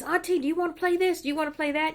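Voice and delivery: put-on high, eager kid asking auntie; child begging auntie to play, high and eager; little boy voice.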